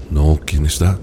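low sea voice, curious. Acted. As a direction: ask low and wondering. Sea asks back. (voz grave y curiosa del mar)

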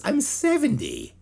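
put-on high, slow whiny old rocker. Mocking [name] begging fans to let him quit, high whiny. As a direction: whine it like a worn-out rock star. Mocking [name]'s own plea.

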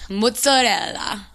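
slow, hammed-up Italian-American "mozzarella"; amazing Italian accent. Shown as a demonstration. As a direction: draw it out in a mock Italian accent. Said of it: "that's my amazing accent right there".

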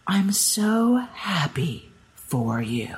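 imitated well-wisher, slow and touched; heartfelt congratulations. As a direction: draw it out with relieved sincerity. Retelling the official's heartfelt congratulations.

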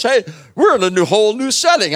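Hey, sudden bright discovery; excited discovery. Acted. Paul in prison, thrilled by a new chance, excited.

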